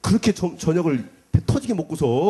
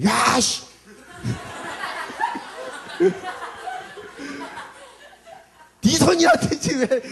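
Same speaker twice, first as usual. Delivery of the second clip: retold angry yell; much slower than usual. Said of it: "내가 막 뭐라고 했지".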